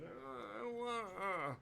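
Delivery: high, soft reluctant whine; puny underling's timid protest; scrappy little voice. Acted. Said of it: "you just hear a scrappy little voice going".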